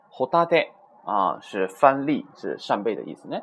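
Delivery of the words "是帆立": ordinary talk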